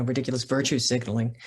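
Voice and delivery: usual speaking voice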